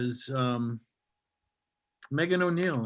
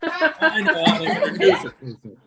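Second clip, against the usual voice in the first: high laughing protest; 12 semitones higher than usual. Real, not acted. Laughing defense of intro.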